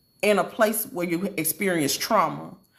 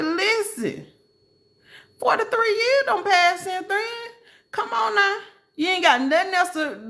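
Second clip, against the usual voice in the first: high-pitched, incredulous scolding; exasperated chiding; 7 semitones higher than usual. Acted. Speaker to a sixty-year-old stuck in glory days, exasperated chiding. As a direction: chide in a high, disbelieving voice.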